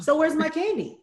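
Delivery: put-on high little-kid demand; child pestering mom. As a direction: imitate a toddler claiming a reward. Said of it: "tries me".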